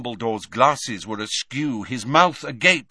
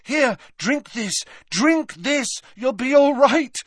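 high, urgent coaxing, growing louder; Harry, desperate; 11 semitones higher, 4 dB louder than usual. A narrator's reading. Harry urging Dumbledore to drink, desperate. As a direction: desperately urge him on. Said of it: "said Harry desperately".

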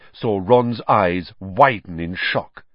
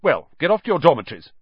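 brisk, fast, higher stern order; 6 semitones higher, 4 dB louder, much faster than usual. A narrator's reading. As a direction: snap it out like a prefect. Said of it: "said Percy sternly".

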